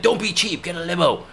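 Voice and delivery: high mock scolding father; yelling dad. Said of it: "he was like".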